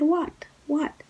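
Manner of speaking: very high, sharp surprised what; alarmed what. Retold or imitated. The woman hearing her ex has something to confess, shocked and high. She hears he must confess.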